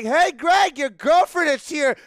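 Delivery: high, loud holler across the yard; gleeful mock announcement, yelled; teasing call-out. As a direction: shout it to put them on the spot. Calling out to embarrass neighbour.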